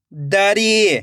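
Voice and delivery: दरी joined as one word with long ई; blended word. Shown as a demonstration. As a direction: read दरी whole, stretching the री. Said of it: "खींच के पढ़ना है".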